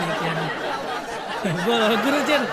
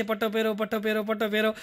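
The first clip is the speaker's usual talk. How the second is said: softer, lower car warning voice; a soft repeated reminder like a car chime; seatbelt alarm voice; 3 semitones lower, 5 dB quieter, faster than usual. Retold or imitated. Car reminding driver about seatbelt, soft mechanical. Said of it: "એમ કેમ કે છે".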